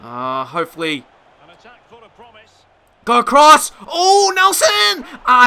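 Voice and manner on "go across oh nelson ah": high, loud, slow wail of the name; anguished cry. Real, not acted. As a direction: cry out as the chance dies. Chance goes wrong for Nelson.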